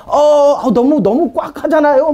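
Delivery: high-pitched mock whining; imitated high, pleading complaint; complaining spirit. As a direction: protest as if squeezed too tight. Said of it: "막 이런 얘기하기도 하고".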